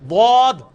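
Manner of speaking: "ضاد" loud, tongue side to teeth; letter dad. Shown as a demonstration. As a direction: sound a heavy "ضاد". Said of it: "وہ تو دال عام ہے،".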